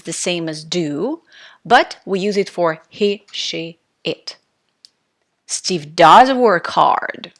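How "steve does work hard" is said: "does" loud and drawn out; slow sentence, punch "does"; stress on does. Shown as a demonstration. Teacher directing attention to the fact, emphatic "does". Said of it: "We want to emphasize".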